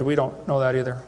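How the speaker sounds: low, lightly voiced professor; mock professor. Professor admitting to a questioner that he doesn't know, mock low voice. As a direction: imitate a professor's answer, pitched right down. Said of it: "I asked this professor".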